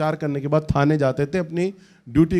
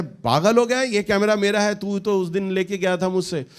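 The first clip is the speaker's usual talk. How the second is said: higher-pitched indignant retort; heated retort; 5 semitones higher than usual. Retold or imitated. Preacher voices Amos fighting for his camera, heated and indignant. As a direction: argue back in an acted quarrel. Acted tug-of-war over camera.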